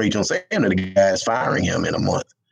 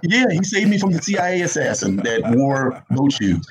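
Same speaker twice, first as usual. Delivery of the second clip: laughing; 8 semitones higher than usual. Real, not acted. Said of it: "That's hilarious".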